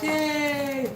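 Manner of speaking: high, bright little mock cheer. Real, not acted. Streamer cheering the hundred twenty in fives. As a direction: celebrate the finished count.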